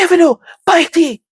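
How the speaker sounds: high, loud exasperated order; mock scolding. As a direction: bark it at the child, half in jest. Uncle scolding child again.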